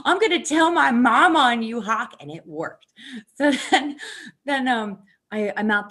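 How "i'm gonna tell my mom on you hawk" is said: high, loud, laughing taunt; mock tattling threat. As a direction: holler it like a tattling kid, amused. Jokes a childish threat to hawk.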